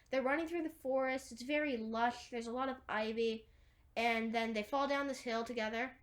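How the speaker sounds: ordinary talk, nothing marked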